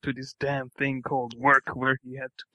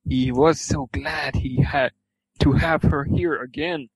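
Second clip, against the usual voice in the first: plain reading voice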